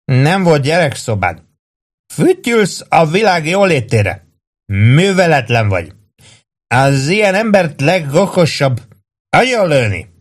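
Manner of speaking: high, slow, swooping reproach; hammed-up tipsy disapproval; mock-serious scolding. A narrator's reading. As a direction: lecture him with drunken mock gravity. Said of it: "mondta komolykodva Warden".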